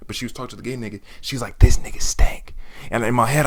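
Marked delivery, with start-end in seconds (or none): 1.6-2.6 breathy unvoiced stage whisper, punchy; imitated whisper. Classmate whispering loudly to her friend about the smell. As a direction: hiss it like a loud classroom secret. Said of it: "I heard her whisper".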